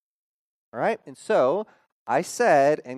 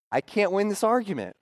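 ordinary delivery

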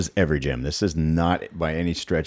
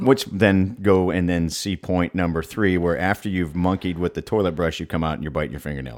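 usual speaking voice

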